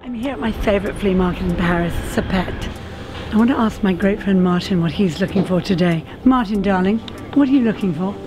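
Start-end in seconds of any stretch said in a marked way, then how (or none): none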